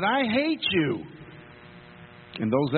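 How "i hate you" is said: mock angry, high, slow I hate you; angry boy. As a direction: hurl it out like a mad kid, pitched up. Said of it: "the boy stormed out mad".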